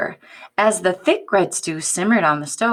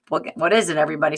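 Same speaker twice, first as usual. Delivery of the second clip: ordinary delivery, nothing marked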